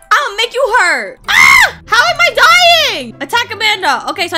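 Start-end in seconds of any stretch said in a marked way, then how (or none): 0.1-1.2 playful threat. Pretend. Threatens the game enemy. 1.3-1.8 high, loud shriek; startled scream. Real, not acted. Hurt in boss fight. 1.9-3.1 high, loud, drawn-out wail; exasperated shout. Real, not acted. Losing the boss fight.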